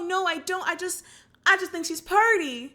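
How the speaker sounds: put-on high, bashful kid insisting; a high bashful boy denying, then confessing he likes her; shy nervous boy. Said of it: "He's just like".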